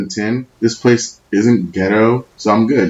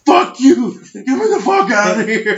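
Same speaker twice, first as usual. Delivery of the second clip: laughing yell; 14 semitones higher than usual. Retold or imitated. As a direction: yell back at them, cracking up. Yelling back at friends from hole.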